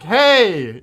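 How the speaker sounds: loud called-out hey; calling hey. Shown as a demonstration. Cohost shows cooee means calling out for attention. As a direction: hail someone across a distance. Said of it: "this is more like".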